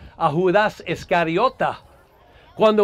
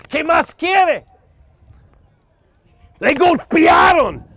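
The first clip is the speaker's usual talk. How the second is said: preaching at full force; 4 semitones higher than usual. Acted. Preacher hammering the congregation with questions, fiery preaching. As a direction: preach hard, driving every question home.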